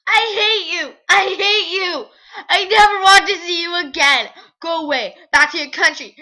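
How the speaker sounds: loud, high, sobbing screams of hatred; furious scream. Acted. Girl screams at the Nazi soldier, furious and sobbing. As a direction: scream your grief at the soldier. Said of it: "I screamed at her".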